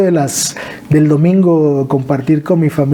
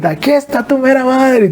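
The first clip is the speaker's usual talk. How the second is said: mother's greeting shout; 10 semitones higher than usual. Retold or imitated. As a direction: belt it out like mom welcoming him home. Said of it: "la voz de su mamá decir".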